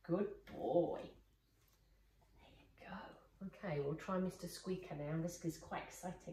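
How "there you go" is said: soft whisper, slow; whispered praise. Real, not acted. Trainer whispering calm praise to her still dog. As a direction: breathe it gently to him.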